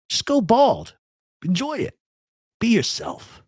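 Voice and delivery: cheerfully mock Seagal, up high; mock advice.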